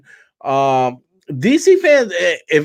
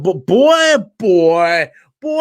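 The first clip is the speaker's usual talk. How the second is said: Foghorn Leghorn; 5 dB louder, much slower than usual. Retold or imitated. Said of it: "that fucking giant chicken from Looney Tunes".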